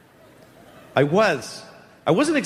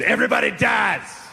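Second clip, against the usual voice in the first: grandfather's blunt announcement; 5 semitones higher than usual. Acted. Grandfather tells a seven-year-old at his birthday, mock shout. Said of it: "My grandfather told me".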